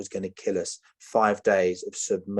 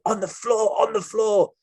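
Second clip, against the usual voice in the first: high, jittery gunman barking orders; a mock panicky kidnapper, pitched way up; nervous gunman; 12 semitones higher than usual. Nervous kidnapper ordering the hostages down, high and panicky.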